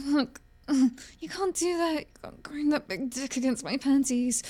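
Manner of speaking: ordinary delivery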